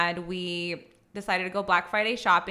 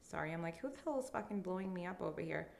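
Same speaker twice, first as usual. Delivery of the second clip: hushed aside; 4 semitones lower, 16 dB quieter, faster than usual. Real, not acted. Phone buzzes mid recording.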